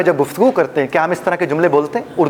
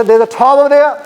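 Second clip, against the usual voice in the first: British accent, "tower over there" with silent r's, higher and louder; English, not Pinglish; 8 semitones higher, 5 dB louder, much slower than usual. Shown as a demonstration. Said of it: "मैंने पिंग्लिश नहीं बोली थी". (अंग्रेज़ों वाला लहजा, र की आवाज़ के बिना)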